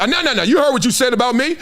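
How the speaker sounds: high-pitched, insistent back-and-forth accusations; hammed-up quarrel, pressing each point; acted heated argument. Acting out a face-to-face trash-talk row, heated and high.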